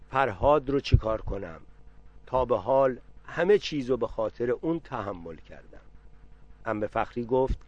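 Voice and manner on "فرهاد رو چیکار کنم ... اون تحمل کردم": ordinary delivery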